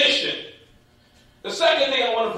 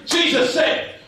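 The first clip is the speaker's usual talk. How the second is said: high, ringing scripture quotation; declamatory preaching; 7 semitones higher than usual. Real, not acted. Preacher quoting Jesus to the congregation, declamatory. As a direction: proclaim the question from the pulpit.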